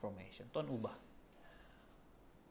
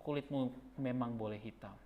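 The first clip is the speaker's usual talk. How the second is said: tender whisper; 3 semitones lower, 5 dB quieter than usual. Acted. Pastor addressing old rival with tender blessing, whispered softly. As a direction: speak it gently like a blessing.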